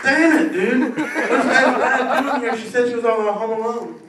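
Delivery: high, loud panicked whine, laughing through it; caught predator. Retold or imitated. Caught man whining to the cops, high and loud. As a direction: squeal it out like a man just caught red-handed. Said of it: "It's like".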